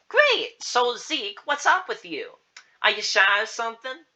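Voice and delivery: very high, loud, peppy voice; enthusiastic client. Acted. Client asking Zeke what's up, enthusiastic. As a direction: greet the child in a high, lively voice.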